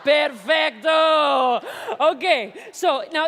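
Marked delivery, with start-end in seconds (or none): 0.0-1.6 slow, loud, stretched praise; showman's loud drawn-out cheer; drawn-out shout. Real, not acted. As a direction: belt out a slow, delighted perfecto. Host praising audience volunteer.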